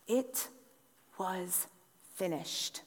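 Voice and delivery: slow, soft and grave, word by word; hushed solemn close. Acted. Preacher to congregation, hushed and solemn about the death. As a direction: let each word fall away into silence.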